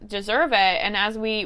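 usual speaking voice

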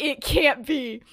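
high, loud, slow disbelieving cry; excited shout. Acted. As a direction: belt it out like you can't believe your eyes. Sirius spotting Jeffrey, story climax.

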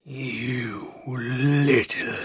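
very slow, menacing cat drawl; snarling cat. Acted. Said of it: "the cat snarled".

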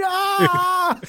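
high-pitched mock scream of pain; imitated scream. Injured dad as his arm gets pulled, imitated scream. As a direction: yelp it out, imitating the injured man. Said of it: "And he's screaming".

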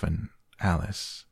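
ordinary delivery, nothing marked

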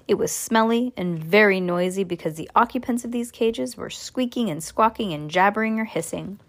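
ordinary delivery

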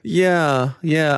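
usual speaking voice

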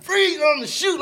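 high, loud police yell; shouted cop command. Retold or imitated. Gunman yelling after him down the hill, high loud shout. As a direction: bark it like a cop mid-chase. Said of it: "He like".

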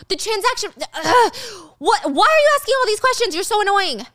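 high, loud, fast irritation; annoyed Michael. Retold or imitated. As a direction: lose patience loudly.

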